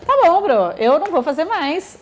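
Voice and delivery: put-on eye-rolling child shrugging off the teacher; dismissive child. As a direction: brush it off like a cheeky kid.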